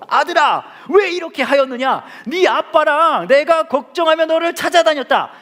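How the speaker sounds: high, loud cry of a reproachful mother; worried mother scolding at full voice; anguished mother. Acted. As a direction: wail it at the lost son, drawn out. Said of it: "어머니가 말했습니다".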